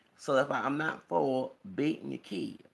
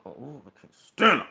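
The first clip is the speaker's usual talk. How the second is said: louder, worked-up groan of dislike; emotional outburst; 8 dB louder than usual. Real, not acted. Ranting about a TV woman she dislikes, worked-up. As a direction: let the irritation boil over. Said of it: "I get all emotional".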